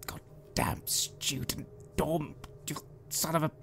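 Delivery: slow, whispery cursing kept to oneself; muttered swearing. Acted. Player's character swearing at the locked dorm door, under the breath. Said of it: "sort of swearing under my breath".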